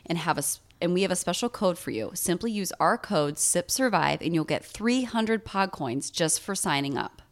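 upbeat sponsor read. Acted. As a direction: sell it like a podcast ad. Podcast ad read.

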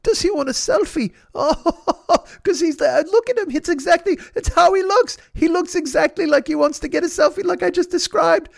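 very high, helplessly laughing impression; John Cleese. Hero amused by the dog selfie joke, high laughing impression. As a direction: squeal it in delight through imitated laughter. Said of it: "laughs the John Cleese laugh".

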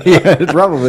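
loud high laughing yes. Real, not acted. Host laughing at the cohost's joke. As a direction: laugh through the words.

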